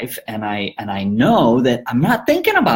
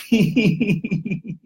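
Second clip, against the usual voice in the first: laughing; 8 semitones higher than usual. Real, not acted. Laughs after point.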